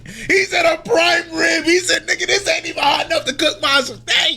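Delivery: high-pitched incredulous squeal; hyped, high-voiced amazement; amazed excitement. Real, not acted. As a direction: squeal it in gleeful disbelief. Amazed retelling of Garp's line.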